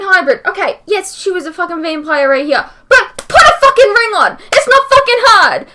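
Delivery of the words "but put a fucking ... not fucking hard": higher and louder, exasperated yelling; fed-up outburst at the screen; furious rant. Real, not acted. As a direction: vent at the writers at full force. Ranting furiously at show.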